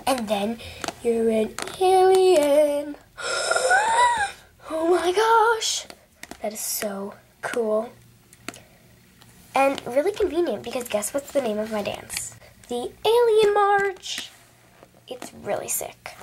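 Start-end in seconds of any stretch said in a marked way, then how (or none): 1.0-5.9 mock amazement. Excited at alien viewer. 12.7-14.3 grand announcement. Pretend. Announcing dance name. 15.1-16.2 hushed aside. Real, not acted. Aside after announcing.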